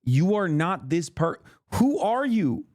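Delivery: building from a slow scold to a high incredulous cry; mock outrage that rises and rises; retold disbelief. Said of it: "I'm like".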